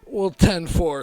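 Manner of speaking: slow, loud and pumped up; eager loud agreement. Retold or imitated. Agreeing to rough sex, loud and eager. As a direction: ten four, said like a man ready to go. Eager reply to her request.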